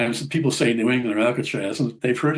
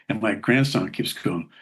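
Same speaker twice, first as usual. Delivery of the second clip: usual speaking voice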